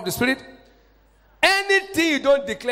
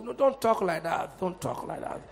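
mock civilized objection; 7 semitones lower, 5 dB quieter than usual. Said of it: "That's the way some of us do".

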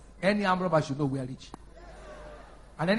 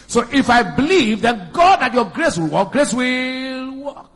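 loud, driving sermon delivery; full-force preaching; 4 semitones higher, 6 dB louder than usual. Real, not acted. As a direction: hammer out the scripture, stretching key words.

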